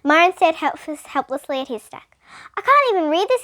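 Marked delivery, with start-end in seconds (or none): 2.5-3.4 put-on higher, louder whine of a stuck kid; complaining. A narrator's reading. Myron complaining about his Chinese page, high whiny whine. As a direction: whine it, helpless. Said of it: "he complained".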